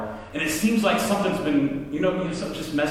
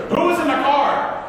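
high, raised-voice demand; angry retold yell, pitched way up; 9 semitones higher, 4 dB louder than usual. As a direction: burst out at the culprits. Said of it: "Who is this pastor yelling".

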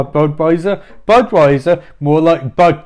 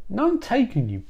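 high, squeaky-pitched offended retort; indignant Elephant Man; 7 semitones higher, 4 dB quieter than usual. Acted. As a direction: jump up high in mock indignation.

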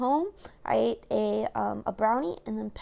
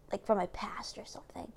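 softer, whispered aside; 2 semitones lower, 9 dB quieter than usual. Real, not acted. Telling viewers about her past, a whispered aside. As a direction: drop to a whisper for the gossip.

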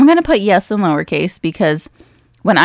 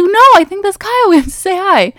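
very high, loud, stretched-out squeal; excited insistence; 13 semitones higher, 4 dB louder than usual. Retold or imitated. Insisting to Chris they greet a friend, very excited. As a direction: re-enact breathless-to-say-hello eagerness, pitched way up. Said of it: "I just got so excited".

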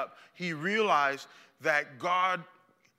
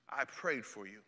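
soft comfort; 8 semitones lower, 10 dB quieter than usual. Acted. Jesus quoted softly.

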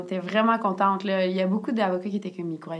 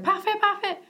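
much higher, eager double parfait; excited relief; 12 semitones higher than usual. Retold or imitated. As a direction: squeal it like a great deal just landed. Quoted reaction to supplier's offer.

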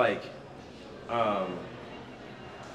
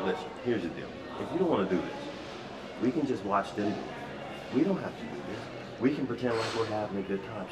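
hushed aside; 4 semitones lower, 8 dB quieter, faster than usual. Retold or imitated. Quietly offering woman an out.

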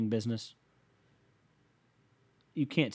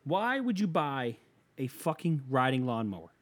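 ordinary delivery

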